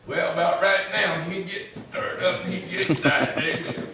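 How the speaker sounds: loud, high and joyful, slowing down with a laugh; excited, laughing. Pretend. Preacher imagining the late deacon finally free to rejoice, laughing excitedly. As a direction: let the joy burst out of you as you picture him.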